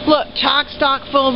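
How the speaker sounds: ordinary talk, nothing marked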